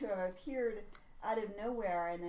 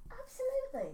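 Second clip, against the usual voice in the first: higher, put-on Kiwi accent; 13 semitones higher than usual. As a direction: Kiwi warden agreeing. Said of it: "he's got some sort of Kiwi accent".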